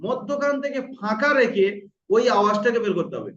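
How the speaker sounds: ordinary talk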